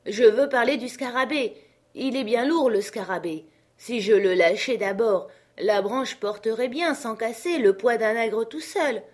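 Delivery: high, loud character voice; complaining servant. Acted. Jupiter pleads to drop the heavy beetle, complaining. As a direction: grumble loudly from up the tree.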